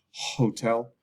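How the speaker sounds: Hotel with a clear H sound; H pronounced. Shown as a demonstration. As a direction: breathe out the H in Hotel. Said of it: "we pronounce the H".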